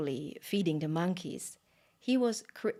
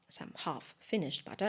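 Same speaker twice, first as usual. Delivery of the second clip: plain reading voice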